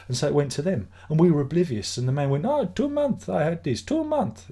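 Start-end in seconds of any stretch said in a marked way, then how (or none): 2.5-4.5 mock Eastern European accent, much higher; lightly imitating the neighbour's Eastern European accent. Streamer quoting the downstairs neighbour, mild Eastern European accent. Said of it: "He's from Eastern European, a guy, he has an accent".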